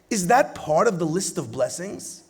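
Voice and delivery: usual speaking voice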